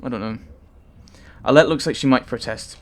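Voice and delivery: ordinary talk, nothing marked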